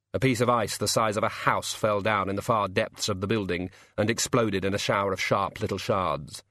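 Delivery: ordinary delivery, nothing marked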